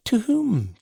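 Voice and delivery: put-on high, insistent girlish cry; girl crying out eagerly to her mother. A narrator's reading. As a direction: demand it twice, pitched high and eager. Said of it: "cried Lise".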